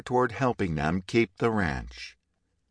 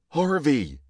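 high, loud yell of a name; 6 semitones higher, 4 dB louder than usual. A narrator's reading. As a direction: yell out in alarm. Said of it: "the other man yelled".

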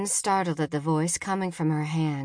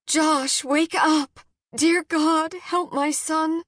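high, urgent cry for help; frantic prayer for her son; panicked mother; 10 semitones higher, 4 dB louder, slower than usual. A narrator's reading. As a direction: plead loudly and high, voice climbing in alarm. Mother panics over unconscious son.

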